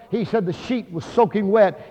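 normal talking voice

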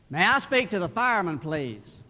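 loud phone voice, dropping at the end; loud telemarketer. Acted. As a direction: ask loudly down the phone. Telemarketer calling a whispering child.

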